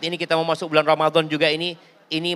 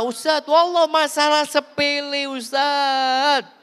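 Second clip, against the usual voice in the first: complaining wife; 7 semitones higher, much slower than usual. Acted. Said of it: "Kalau suami marah".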